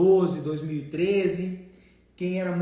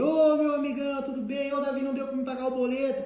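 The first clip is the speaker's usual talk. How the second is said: higher, louder mock buddy greeting, quick; overly chummy debtor; 7 semitones higher, 3 dB louder than usual. Debtor phoning the collector as an old friend, higher and louder mock buddy voice. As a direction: play the debtor who treats the collector as a pal. Debtor calls, too familiar.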